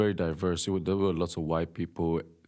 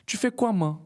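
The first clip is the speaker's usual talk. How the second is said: much higher Togolese street French ending on ma; 13 semitones higher, 3 dB louder than usual. Retold or imitated. Showing how Togolese add ma, high mock Togolese French. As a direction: sing out the ma at the end. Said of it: "It's like".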